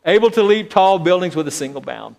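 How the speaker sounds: mock superhero announcer. Pastor mocking his own pride as a superhero, hammed-up announcer. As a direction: puff it up like a comic-book hero.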